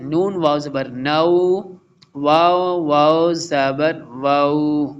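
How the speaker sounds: slow careful recitation, तऊ said short, not stretched; short vowel recitation. Shown as a demonstration. Teacher reciting slowly for the students, keeping the vowel short. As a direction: chant each letter combination slowly with a short ending. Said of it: "last line पढ़ लेते हैं".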